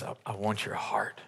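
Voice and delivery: very soft, tender plea; almost whisper it as God's longing. Acted. God speaking to his people, a soft plea.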